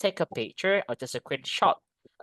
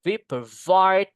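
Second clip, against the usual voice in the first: slow, raised, loud "vide" in "provide"; stress on second syllable; 4 semitones higher, 6 dB louder, slower than usual. Shown as a demonstration. Teacher giving an example sentence, heavy stress on "vide" in "provide". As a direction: stretch and punch "vide" in "provide". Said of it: "di suku kata yang kedua".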